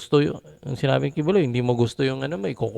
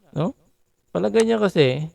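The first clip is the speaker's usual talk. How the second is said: normal talking voice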